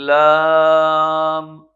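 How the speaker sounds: "لام" drawn out long and loud; slow deliberate "لام"; careful lam. Shown as a demonstration. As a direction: hold the "لام" slowly. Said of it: "اس میں بھی وہی احتیاط ہے".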